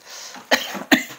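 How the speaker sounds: short dry cough. Real, not acted. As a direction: cough into the mic. Coughs mid-reading.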